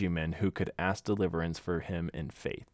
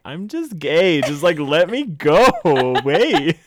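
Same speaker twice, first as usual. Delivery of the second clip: higher, louder, slow pleading; pleading younger self; 9 semitones higher, 11 dB louder, slower than usual. Retold or imitated. Thirteen-year-old self begging his uncle to let him leave. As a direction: beg to be let go, half laughing. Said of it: "And I'm like".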